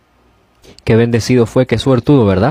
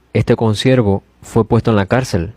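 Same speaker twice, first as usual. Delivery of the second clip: usual speaking voice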